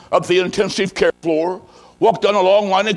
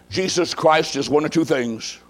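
ordinary talk, nothing marked

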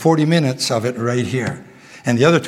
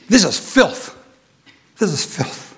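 sinner's lament; 4 semitones higher, slower than usual. Retold or imitated. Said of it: "the cry of the sinner".

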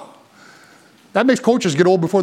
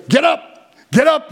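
imitating a coach shouting get up; coach yelling; 8 semitones higher, slower than usual. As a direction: bark it like a coach on the sideline. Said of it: "How many times did your coach tell you".

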